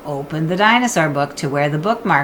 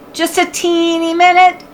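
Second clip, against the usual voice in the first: coaxing little sister; 11 semitones higher, 4 dB louder than usual. Acted. Annie coaxing, high voice.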